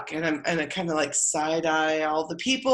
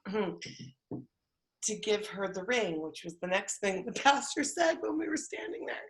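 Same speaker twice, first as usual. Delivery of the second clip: hushed whisper, much softer; 7 dB quieter than usual. Retold or imitated. At the altar, handing over the ring, she whispers much softer. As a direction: whisper as if the pastor is speaking.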